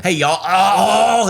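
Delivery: exaggerated American vowels from down in the throat; choked-vowel American accent. Shown as a demonstration. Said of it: "down in there".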